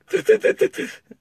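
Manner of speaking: high, stuttering mock sputter; imitated losing fight with tears. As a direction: sputter in rapid high bursts, imitating held-back crying. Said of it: "that kind of thing is happening".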